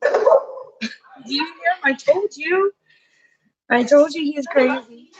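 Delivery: high, slow, amused voice; amused disbelief. Real, not acted. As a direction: marvel at the crazy bird. Amused at the noisy bird.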